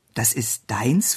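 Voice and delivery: slow, hushed question rising at the end; astonished. A narrator's reading. Maid questioning the little girl's claim, astonished. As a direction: ask it low and slow in disbelief. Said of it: "fragte das Mädchen erstaunt".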